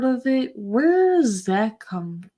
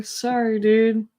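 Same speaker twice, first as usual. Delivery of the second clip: ordinary delivery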